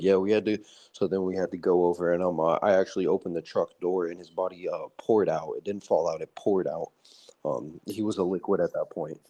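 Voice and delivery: low, nonchalant veteran impression; Nicholas Irving. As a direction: describe the horror calmly and matter-of-factly. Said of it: "That's literally, like, how he talks".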